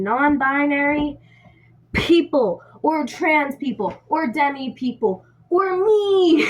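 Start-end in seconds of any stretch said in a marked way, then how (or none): 5.5-6.4 playful squeal. Real, not acted. Joking self-inclusion, high pitch.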